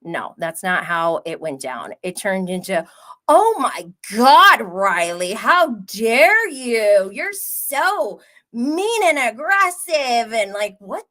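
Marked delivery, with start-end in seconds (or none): 3.3-10.3 high, loud, drawn-out mock offense; mock outrage. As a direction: ham up a dramatic overreaction, slow. Mocking Georgia's dramatic reaction.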